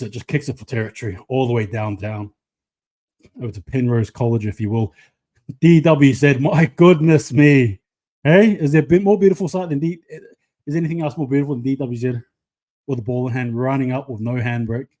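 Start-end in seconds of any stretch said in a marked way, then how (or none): 5.6-12.0 loud, higher burst of amazement; thrilled commentary. Real, not acted. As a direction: marvel aloud at a brilliant run. Thrilled reaction to a run.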